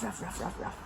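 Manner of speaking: hushed, low run of ruffs; dog bark. Shown as a demonstration. Telling a child the dog says ruff, hushed pretend bark. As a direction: bark very softly, over and over. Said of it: "Dog says ruff".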